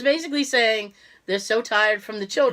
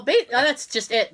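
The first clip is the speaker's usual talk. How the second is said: usual speaking voice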